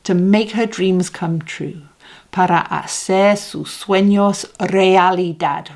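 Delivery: ordinary talk, nothing marked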